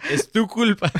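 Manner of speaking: giggling accusation at the ex; laughing. Real, not acted. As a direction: blame her through laughter. Joking blame at ex-girlfriend.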